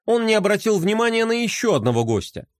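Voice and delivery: ordinary delivery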